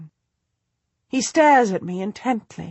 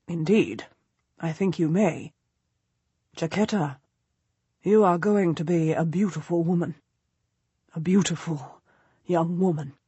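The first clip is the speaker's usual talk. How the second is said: low leering nobleman; 3 semitones lower, 4 dB quieter than usual. A narrator's reading. Duke's low, leering compliment.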